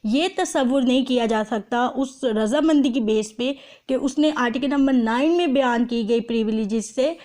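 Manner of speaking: ordinary talk, nothing marked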